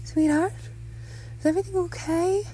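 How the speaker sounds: high-pitched motherly concern. A narrator's reading. Mother checks on son at dinner, concerned high voice. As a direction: check on your child in a high, worried voice.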